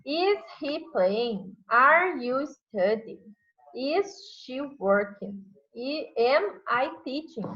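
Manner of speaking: each English question said very slowly and clearly; slow, clear classroom demo; slow example questions. Shown as a demonstration. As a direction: model the questions slowly for students. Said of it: "Então".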